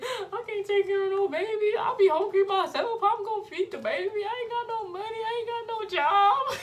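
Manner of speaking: high, loud laughing sob; crying. Retold or imitated. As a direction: wail it through half-laughing tears. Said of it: "like, crying".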